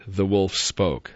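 plain reading voice

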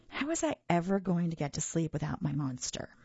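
ordinary delivery, nothing marked

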